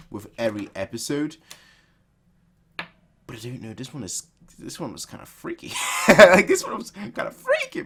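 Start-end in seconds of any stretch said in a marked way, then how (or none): 4.6-5.7 hushed, hesitant remark; quiet unease. Real, not acted. First take, softly said. 6.1-7.8 high-pitched, laughing exclamation; cracking up. Real, not acted. Cracks up repeating it.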